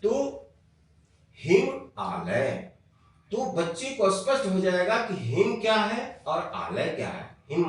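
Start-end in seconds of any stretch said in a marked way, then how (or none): 1.4-2.7 very slow "हिम आलय", hिम and आलय apart; split into parts. Shown as a demonstration. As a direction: pull the parts apart slowly. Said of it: "जोड़ करके हम सिखाएं". ("हिम आलय" बहुत धीरे, अलग-अलग)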